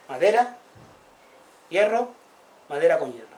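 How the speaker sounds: name each object proudly and loudly; emphatic naming. Retold or imitated. Oral villagers listing objects, loud and emphatic.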